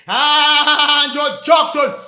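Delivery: ordinary delivery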